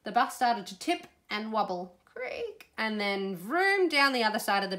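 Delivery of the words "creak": high, soft imitated creak; thin, high-pitched creak; creak noise. A narrator's reading. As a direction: squeak it like straining metal. Bus wobbles in story.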